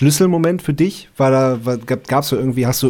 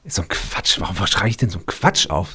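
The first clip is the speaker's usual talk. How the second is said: half-whispered self-scolding, pitched lower; muttering at himself; 7 semitones lower, 6 dB quieter than usual. Real, not acted. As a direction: grumble under your breath about your own mistake. Scolds himself for a wrong note. (leise, halb geflüsterte Selbstkritik)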